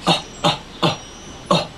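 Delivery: throaty little coughs; cough demo. Shown as a demonstration. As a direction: cough from the throat, short and dry. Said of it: "So it's like a".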